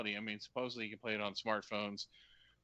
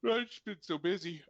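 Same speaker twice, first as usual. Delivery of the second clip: said through a yawn; 8 semitones higher, 5 dB louder than usual. Real, not acted. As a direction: yawn while you talk. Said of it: "sorry for the yawn".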